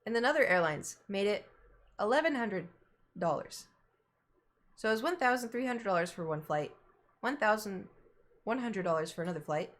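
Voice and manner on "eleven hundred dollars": ordinary talk, nothing marked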